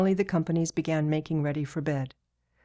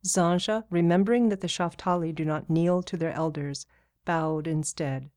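plain reading voice

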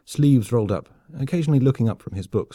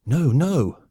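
higher, urgent protest; panting distress; 5 semitones higher, 4 dB louder than usual. A narrator's reading. Exhausted soldier contradicting his friend, panting. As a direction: protest while short of breath. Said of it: "Unable to speak, Eddie bent over and panted".

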